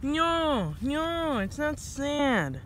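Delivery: high, drawn-out wail of denial; whiny protest. Acted. Cat yokai rejects pity, whiny protest. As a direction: protest in long high swoops.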